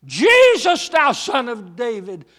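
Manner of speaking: loud, high desperate shriek of Jesus. Acted. Blind man shrieking for Jesus, loud and high. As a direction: scream the name in desperation. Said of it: "when this man shrieked".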